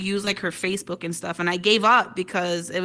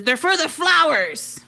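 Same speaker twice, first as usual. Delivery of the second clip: dad; 8 semitones higher, 5 dB louder, slower than usual. Retold or imitated. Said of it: "he looks at me and he says".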